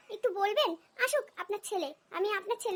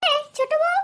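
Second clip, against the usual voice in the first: high, loud summons across the house; loud calling out; 6 semitones higher, 7 dB louder than usual. Acted. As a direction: holler for the daughter-in-law, scolding. Calling younger daughter-in-law to scold. (চড়া গলায় হাঁক দিয়ে ডাকা)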